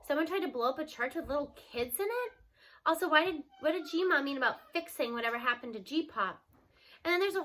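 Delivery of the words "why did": usual speaking voice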